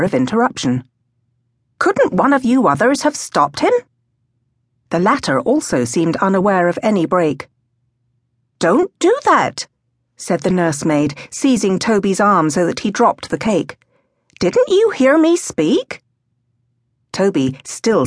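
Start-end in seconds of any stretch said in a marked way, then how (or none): 1.8-3.7 higher-pitched reproachful question; nursemaid's exasperated reproach; scolding nursemaid. A narrator's reading. 8.6-9.7 high, slow scolding; scolding a small child; nursemaid's reprimand. A narrator's reading. Nursemaid scolds, seizing boy's arm. 14.4-16.0 high, slow reproachful question; drawn-out, high-pitched reproach; scolding nursemaid. A narrator's reading. Nursemaid scolds ignoring child.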